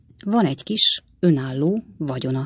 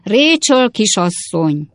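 slow, raised-voice holler; high, loud, drawn-out announcement; shouted into ear; 4 semitones higher, 5 dB louder, slower than usual. A narrator's reading. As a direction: call it out slowly for a deaf listener. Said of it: "beleordította az öreg néni fülébe".

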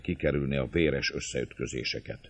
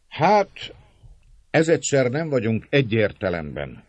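jókedvű szónok magasabb, hangos hangja; szólj derűsen a tömeghez; 8 semitones higher, 7 dB louder than usual. A narrator's reading. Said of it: "szólt jókedvűen". (jókedvűen, emelt hangon)

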